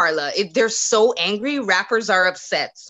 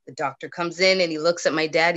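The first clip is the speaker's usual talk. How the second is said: usual speaking voice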